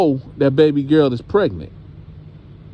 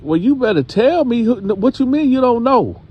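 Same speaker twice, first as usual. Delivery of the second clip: higher-pitched indignant mock interrogation; demanding; 11 semitones higher than usual. Grandma presses her pregnant girl for answers, indignant and high. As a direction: act out a mother demanding an answer.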